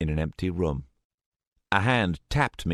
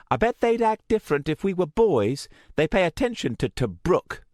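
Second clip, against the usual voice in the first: resentful rant; 12 semitones higher, 4 dB louder than usual. A narrator's reading.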